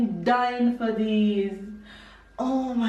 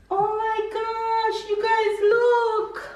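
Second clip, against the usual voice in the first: very high, slow, stretched-out words; thrilled amazement; 10 semitones higher, slower than usual. Real, not acted. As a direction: marvel in a high, drawn-out voice. Shows off gift to viewers.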